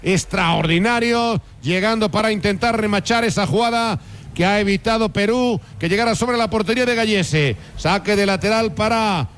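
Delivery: high, sustained football commentary; live play-by-play. Real, not acted. Radio commentator calling Spain's attack to listeners, live play-by-play. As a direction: call the attack in high, ringing tones.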